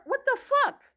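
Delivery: sudden high squeal of disbelief; high exasperated cry; incredulous outburst. Retold or imitated. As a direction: spike way up in outrage. Retold inner outrage.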